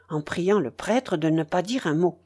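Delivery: usual speaking voice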